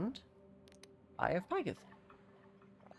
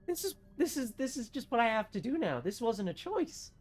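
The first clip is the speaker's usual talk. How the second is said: high, plaintive little voice; small mock whine; 8 semitones higher than usual. Stuck gamer accepting there was no choice, plaintive mock whine. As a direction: whimper it in hammed-up resignation.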